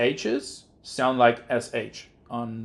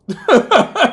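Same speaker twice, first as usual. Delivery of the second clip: loud laugh; 13 semitones higher, 12 dB louder than usual. Real, not acted. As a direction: burst of high laughter. Laughs at fish meme.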